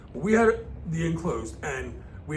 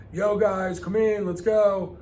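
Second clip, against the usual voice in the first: mom calling out; 4 semitones higher, 5 dB louder than usual. Retold or imitated. Mom calling kids in for dinner, hollering.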